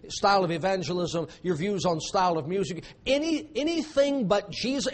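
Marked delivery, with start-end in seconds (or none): none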